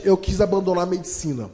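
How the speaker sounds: ordinary delivery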